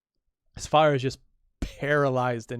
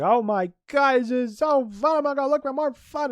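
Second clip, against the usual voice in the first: put-on high, louder, giddy childish squeal; giddy kid voice; 12 semitones higher, 5 dB louder than usual. Draco delighted after stamping on the man, squealing. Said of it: "He's like".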